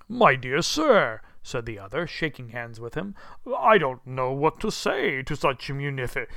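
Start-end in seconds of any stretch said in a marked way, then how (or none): 0.1-1.2 loud, slow, heartfelt exclamation; overwhelmed old gentleman. A narrator's reading. Old gentleman shakes hands with Scrooge, overwhelmed. 3.6-6.3 louder, wondering, at a loss; overwhelmed old gentleman. A narrator's reading. Old gentleman thanks Scrooge for his gift, overwhelmed.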